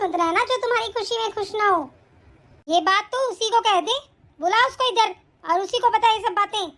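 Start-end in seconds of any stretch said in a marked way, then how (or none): none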